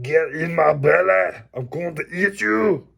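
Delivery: loud, high, put-on greedy voice; silly monster voice. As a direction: growl it like a hungry cartoon glutton. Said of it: "Be like".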